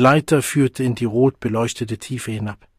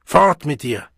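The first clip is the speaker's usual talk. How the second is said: wütend; 3 semitones higher, 5 dB louder than usual. Acted. Men drive the boy off.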